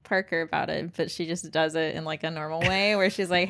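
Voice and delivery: ordinary talk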